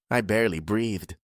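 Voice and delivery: usual speaking voice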